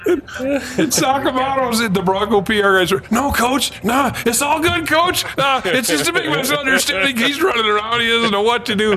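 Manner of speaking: high, laughing retelling; laughing through it. Real, not acted. Writer retelling the PR men scrambling, laughing. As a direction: talk through the giggles.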